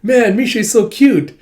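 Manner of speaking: high, loud gushing, slowing at the end; excited fan. Retold or imitated. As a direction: melt over the cute cat. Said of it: "It's like".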